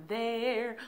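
ordinary delivery

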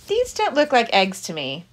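normal talking voice

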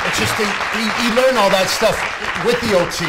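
high, loud, urgent plea. Real, not acted. Father pleading with the mayor about his son's therapy, high loud urgent. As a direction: push the point hard, almost shouting.

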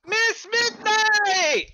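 high, slow, drawn-out squeal of her name; delighted cry. Acted. Hunter spots Lady Midnight and cries out delightedly.

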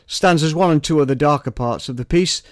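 usual speaking voice

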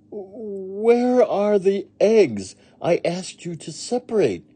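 high, slow, strained question; Missus Rogers demanding. A narrator's reading. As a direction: ask slowly with growing exasperation. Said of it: "said Missus Rogers".

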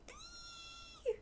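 very high thin delighted squeal. Acted. Friends squealing over the license plate. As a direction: squeal like excited girls.

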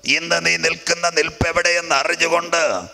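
high, insistent sermon voice; fervent preaching. Real, not acted. Preacher warning convention listeners about Babylon's sin, fervent preaching. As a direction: press the warning on every listener.

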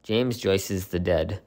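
ordinary talk, nothing marked

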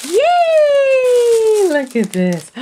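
loud high squeal of joy; delighted yay. Real, not acted. Crafter opening her supplies, loud high squeal.

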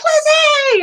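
very high, loud, drawn-out greeting; imitated excited squeal. Imitating small girl greeting her with hug, squealing. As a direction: mimic a small person's gleeful shriek. Retold girl's greeting while hugging.

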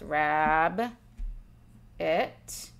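rab then it, spaced apart; syllable split. Shown as a demonstration. Teacher modelling rab it in two boxes. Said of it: "in one box".

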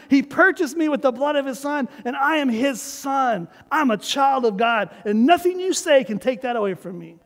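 usual speaking voice

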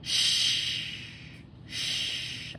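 long, hushing "sh"; SH sound. Shown as a demonstration. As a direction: draw out "sh" as pure breath. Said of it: "I need that S H".

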